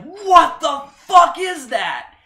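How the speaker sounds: high, loud, slow drawn-out disbelief; stunned outburst. Retold or imitated. Fan reliving the trailer reveal, loud stunned disbelief. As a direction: let it rip like seeing the monster.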